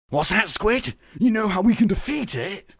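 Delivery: the Doctor asks Squid, hopeful and eager; excited gasp. Acted. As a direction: ask it with sudden hope. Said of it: "gasped the Doctor".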